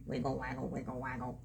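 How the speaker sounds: low, soft waddling rhythm; low duck waddle. Acted. Reader shows child how a duck walks, low soft waddle. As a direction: drop the voice for a rocking waddle. Voices the duck's walk.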